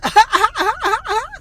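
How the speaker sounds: very high, loud mock cackle; shrieking staccato imitated cackle; Guerrilla Harris cackle. Laughing at her own answer, imitated high cackle. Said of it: "The way that she fucking cackles".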